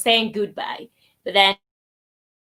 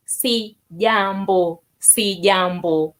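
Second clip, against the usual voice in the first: Sijambo said very slowly and lower; slow reply demo; 3 semitones lower, slower than usual. Shown as a demonstration. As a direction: draw sijambo out for learners. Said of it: "and the response is".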